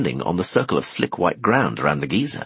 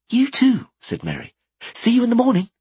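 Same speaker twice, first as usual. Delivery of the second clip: put-on higher, brighter girl voice; young woman voice. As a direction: play Merry, lively. Merry's voice.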